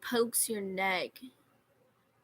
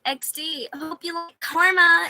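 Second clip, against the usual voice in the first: high, loud taunting; mock the girl with a smug jab; loud, high gloating taunt; 9 semitones higher, 12 dB louder than usual. Taunting retold chat message.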